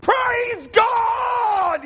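high, loud mock shout of praise; hammed-up cry of praise; joyful shout. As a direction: yell it like he's jumping for joy. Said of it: "And he said".

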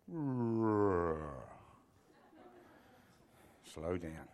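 long falling drawl, winding down low; slowing down. Shown as a demonstration. Preacher showing guests he can slow down, exaggerated slowing. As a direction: drag it out like a motor running down. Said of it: "Am I gonna have to slow down".

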